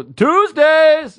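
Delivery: high, drawn-out fan shout; imitated crowd holler. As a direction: holler it like fans in an arena. Crowd hollering catchphrase at him.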